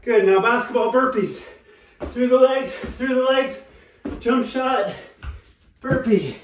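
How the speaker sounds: energetic coach callout. Real, not acted. Coach calling out burpee moves.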